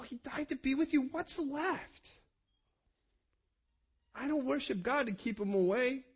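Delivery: ordinary talk, nothing marked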